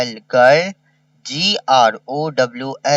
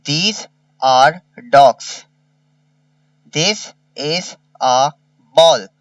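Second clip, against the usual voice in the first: drawn out, word by word; slow dictation; much slower than usual. Shown as a demonstration. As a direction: dictate slowly to pupils. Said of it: "slow dictation read".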